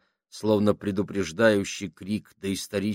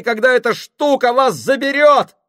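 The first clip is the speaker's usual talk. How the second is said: high, loud breathless shout; gasping; 12 dB louder than usual. Acted. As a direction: scream it out of breath. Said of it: "задыхаясь".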